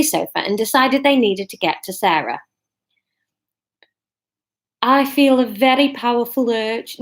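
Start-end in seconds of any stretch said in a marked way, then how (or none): 4.8-7.0 put-on Liverpool accent slipping in; Scouse-tinged character voice; accidental Scouse. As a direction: aim for Brummie and land in Scouse. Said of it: "No, that's Scouse".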